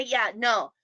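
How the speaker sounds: ordinary delivery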